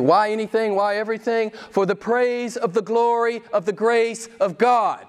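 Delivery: rising, emphatic preacher's refrain; preaching. Acted.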